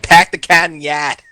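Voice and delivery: slow mock Boston accent; exaggerated Massachusetts accent. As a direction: drop the R's like a Bostonian. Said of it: "that's the, the Harvard God".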